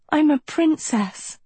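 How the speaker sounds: high, delighted announcement; glowing with happiness. A narrator's reading. Sophie tells Agatha her dream, glowing with happiness. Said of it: "glowing with happiness".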